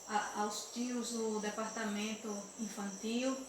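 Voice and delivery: soft hushed prayer voice. Acted. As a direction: pray gently for the children. Leads a prayer with the children. (oração suave e reverente)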